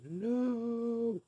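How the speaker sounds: long sky-high howled no; Darth Vader style no. Retold or imitated. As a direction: cry out the no like a movie villain losing it. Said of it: "Darth Vader style".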